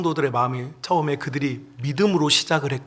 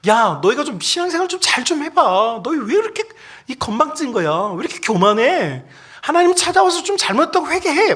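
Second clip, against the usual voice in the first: higher-pitched acted telling-off; mock scolding; 8 semitones higher than usual. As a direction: scold the congregation in a raised pitch. Acting God scolding lay people.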